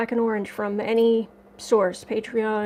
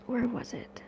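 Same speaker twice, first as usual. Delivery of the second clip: barely voiced question to herself; whispered to self; 11 dB quieter than usual. Real, not acted. Scrolling comments alone, asking herself in a whisper. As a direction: mutter it under your breath.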